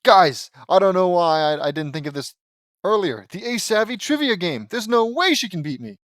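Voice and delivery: put-on high, loud, eager boy hero; excited brainwave. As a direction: announce your great idea with confidence. Lincoln's excited idea.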